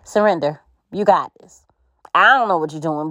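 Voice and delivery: usual speaking voice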